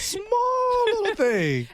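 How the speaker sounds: high, drawn-out fake crying; hammed-up sob; mock wail. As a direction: wail it like a child in tears, very slowly. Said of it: "mock wailing over small thing".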